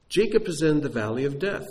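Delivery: ordinary talk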